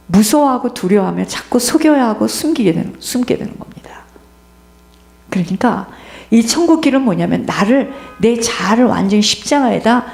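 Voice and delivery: ordinary delivery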